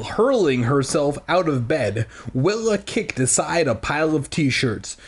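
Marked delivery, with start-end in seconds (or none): none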